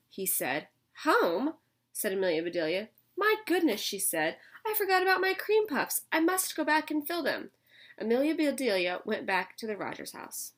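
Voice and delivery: lively read-aloud storytelling voice; picture-book reading for young kids; story narration. A narrator's reading. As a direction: read it to the class with character voices.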